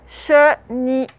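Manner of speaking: CHENILLE drawn out slowly, syllable by syllable; syllable-by-syllable dictation. Shown as a demonstration. Teacher dictating a word to pupils, syllable by syllable.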